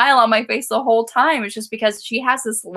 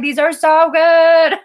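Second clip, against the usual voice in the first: high, loud, drawn-out fan gush; re-enacted fangirl enthusiasm; gushing excitement; 6 semitones higher, 7 dB louder, slower than usual. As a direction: stretch it out in loud, excited praise. Quoting own gushing tweets.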